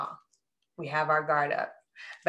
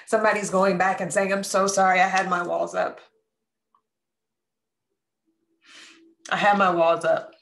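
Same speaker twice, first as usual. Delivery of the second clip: moved, pleading apology, a little louder; getting emotional; 3 semitones higher, 4 dB louder than usual. Real, not acted. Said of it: "I'm sorry, I'm getting emotional".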